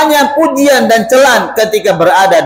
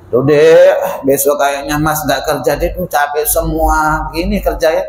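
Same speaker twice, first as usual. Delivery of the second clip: tired complaining husband; 6 semitones lower, 3 dB quieter than usual. Retold or imitated. Preacher imitates a tired husband moaning to his wife, low and sighing. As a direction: grumble like a husband too tired to work.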